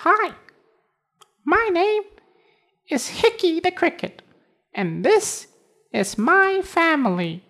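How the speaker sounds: very high tiny cricket voice. Acted. Cricket introduces himself.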